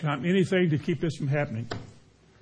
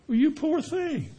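mock sympathy; 10 semitones higher, slower than usual. Sympathetic man voiced in story.

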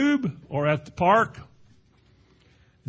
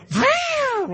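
much higher revving vroom, held long; vroom engine sound; 4 dB louder than usual. Retold or imitated. Preacher showing how the wrench should sound, high revving vroom. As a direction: rev it up like a healthy motor. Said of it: "You're supposed to go".

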